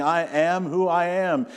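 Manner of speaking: slow, solemn and lifted; voice of God. Acted. Preacher quoting God from the burning bush, slow solemn proclamation. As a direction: proclaim it slowly like a voice from the fire.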